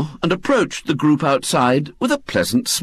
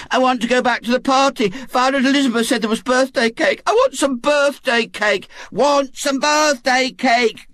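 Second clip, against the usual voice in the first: put-on much higher, fast whiny kid voice demanding cake; a spoilt child chanting for more party; clamouring children; 11 semitones higher than usual. Party children clamour to go back for cake, whiny. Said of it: "clamoured the chorus".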